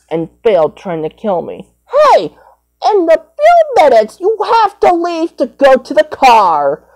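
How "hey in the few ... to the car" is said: high, louder pretend character voice; high toy voice. A child voicing a toy telling another to leave, high and loud. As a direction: call it out like a bossy cartoon.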